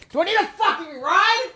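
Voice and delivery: very high, strained outburst of fury; outraged squeal. Retold or imitated. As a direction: explode into a high-pitched screech of disbelief. Explodes at the taunt.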